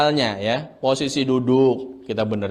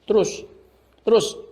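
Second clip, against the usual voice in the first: strained, building push; 5 semitones higher than usual. Shown as a demonstration. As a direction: voice rising and tightening. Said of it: "Kita sempitkan dadanya".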